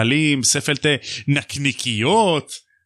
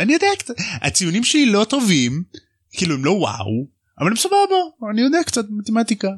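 lightly voiced Harry, pitched up and unsure; high timid boy; 8 semitones higher than usual. Harry to Hagrid, about his grades, in a high unsure voice. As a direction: squeak it out like a nervous kid.

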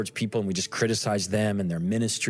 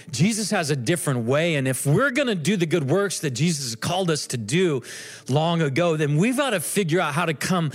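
higher, louder preacher build; fervent preaching; 6 semitones higher, 3 dB louder than usual. Acted. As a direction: preach it with rising fire. Preaching at rising force.

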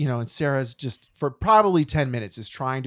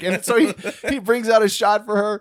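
ordinary talk

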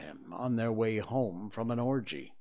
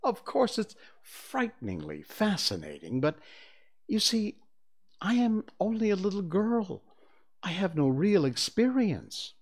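lightly voiced Wendy; 8 semitones higher than usual.

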